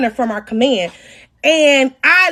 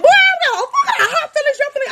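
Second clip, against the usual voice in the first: much higher, fast shouted rant with laughter; laughing furious yell; 12 semitones higher, faster than usual. Retold or imitated. Woman ranting at the married man, shouting and laughing. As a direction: scream that she doesn't care, half laughing.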